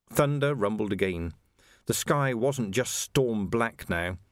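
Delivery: usual speaking voice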